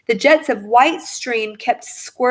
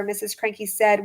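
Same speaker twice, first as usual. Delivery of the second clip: usual speaking voice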